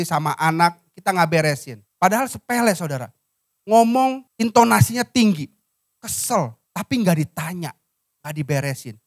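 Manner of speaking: ordinary delivery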